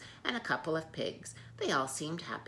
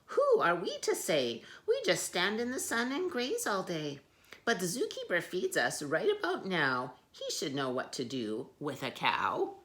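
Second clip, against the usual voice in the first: higher, lively zebra voice; cheerful zebras; 4 semitones higher, 4 dB louder than usual. Acted. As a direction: chirp it friendly. Zebras speak.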